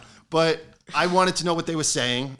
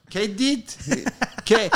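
puzzled Italian nonna; 8 semitones higher, 6 dB louder, much slower than usual. Retold or imitated. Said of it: "she's like".

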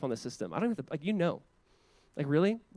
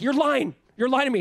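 accusing; 8 semitones higher, 8 dB louder than usual. Retold or imitated. Ahab accuses Micaiah.

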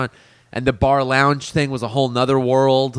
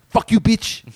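Edson's angry comeback; 8 semitones higher than usual. Retold or imitated. Server imitating the busboy Edson, mock angry and higher. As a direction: snap back as the hot-tempered busboy. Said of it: "pissed off fucking little Mexican guy".

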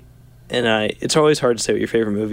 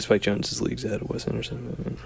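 mumbled, quiet and slurred; mumbly; 8 dB quieter than usual. Real, not acted. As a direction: mumble it under your breath. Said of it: "That was very mumbly".

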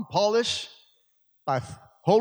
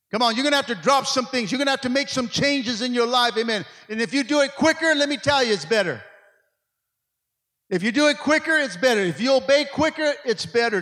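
higher, driving sermon delivery; full-force preaching; 5 semitones higher, 3 dB louder than usual. Real, not acted. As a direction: preach it out to the church with punched key words. Urges the congregation to change.

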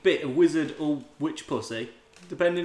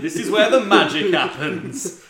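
slow, louder showman boast; 3 semitones higher, 6 dB louder than usual. Retold or imitated. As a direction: present it slowly and proudly. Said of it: "Just pointing at it".

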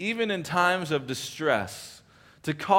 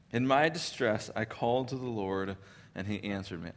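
quieter second reading that fades out on "answered me"; soft repeat; 5 semitones lower, 6 dB quieter than usual. Shown as a demonstration. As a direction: let the repeat sink down to almost nothing. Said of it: "and he starts his prayer".

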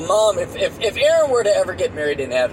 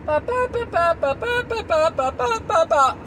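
high mocking babble; 12 semitones higher than usual. Mimics rambling answer.

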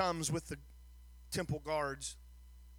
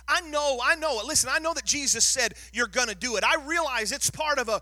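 louder and higher, hammering each point to the crowd; preaching at full force; 5 semitones higher, 8 dB louder than usual. Acted. Pastor pressing the congregation on sin, preaching at full force. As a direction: drive the message home like a revival preacher.

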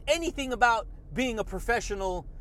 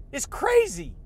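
normal talking voice

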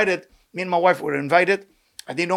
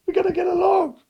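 crying shout; 11 semitones higher than usual. Real, not acted. Crying out to the congregation.

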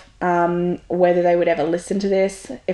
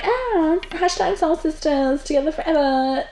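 high, sing-song mock of gushing friends; 8 semitones higher than usual. Mocking former network-marketing friends in a gushing sing-song. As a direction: camp up the fake sisterly sweetness.

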